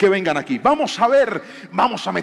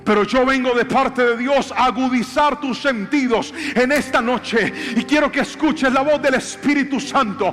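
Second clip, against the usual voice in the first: high, driving sermon delivery; fervent pulpit proclamation building in intensity; impassioned preaching; 7 semitones higher than usual. Real, not acted. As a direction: preach it urgently at a raised pitch. Preaches at full force.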